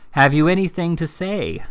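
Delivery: a menacing question, cane in hand; stern demand. A narrator's reading. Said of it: "demanded Squeers again".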